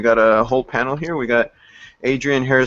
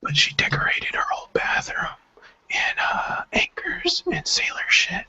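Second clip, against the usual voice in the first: hushed gossip whisper; secretive whisper; worried whisper; 7 dB quieter than usual. Real, not acted. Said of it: "we whispering?".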